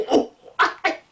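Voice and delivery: baffled viewer. Retold or imitated. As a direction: play the lost moviegoer, squeaky and baffled. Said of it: "you're like".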